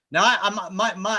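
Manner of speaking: usual speaking voice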